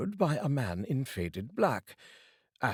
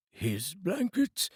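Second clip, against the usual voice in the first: higher, slow, taken aback; a hint of a startled old dealer; surprised old Joe; 10 semitones higher, much slower than usual. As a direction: echo it in disbelief. Old Joe voiced in story.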